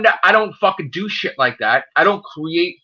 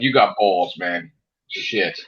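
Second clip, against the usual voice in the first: low, softer mock-impressed mutter; lightly voiced low impressed aside; 13 semitones lower, 4 dB quieter, much slower than usual. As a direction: drop low like a grudging admirer. Impressed reaction, retold.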